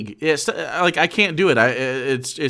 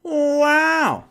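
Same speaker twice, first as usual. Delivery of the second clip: surprised; 13 semitones higher, 3 dB louder than usual. Real, not acted. Reacting to speech ending.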